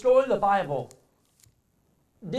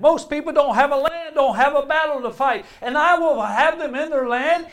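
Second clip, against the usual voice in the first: loud, ringing preacher's voice, pitched higher; preaching at full force; 7 semitones higher, 9 dB louder than usual. Acted. Preacher proclaiming God's promise to the congregation, loud and high. Preacher reading the prophecy aloud.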